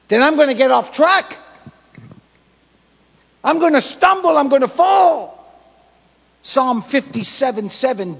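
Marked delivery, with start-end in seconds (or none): 0.1-5.4 loud, high-pitched preacher's warning; raised voice, hammering each phrase home; full-force preaching. Real, not acted. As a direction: shout the warning to the congregation.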